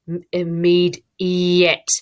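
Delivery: IMMEDIATELY broken into slow, stretched syllables; slow sounding-out. Shown as a demonstration. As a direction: hunt for the missing sound in "immediately", slowly. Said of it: "I've said it slowly".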